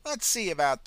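put-on high, sweet old lady voice; old woman. A narrator's reading. Old pensioner cheerfully greeting the ambulance officer, high and sweet. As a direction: chirp it like a kindly granny.

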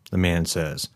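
usual speaking voice

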